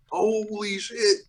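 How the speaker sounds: high, drawn-out astonishment; slow, high-pitched awe, quoting a buyer; mock freak-out. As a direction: imitate someone freaking out, stretching it out high. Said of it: "and they're freaking out".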